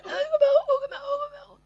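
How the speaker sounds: very high wail breaking into laughter; laughing mock drowning cry. Preacher half laughing while acting the drowning man.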